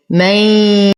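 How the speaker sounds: من melting into a long nasal y; idgham with ghunna. Shown as a demonstration. Teacher reciting for students, من with merged nasal ghunna. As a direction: hum the end of من into a y. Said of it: "إدغام يحتاج إلى غنة".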